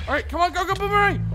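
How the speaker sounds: high, loud urging; urgent shout. Real, not acted. Gamer sending the boomerang off, urgent shout. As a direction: spur it on, voice climbing.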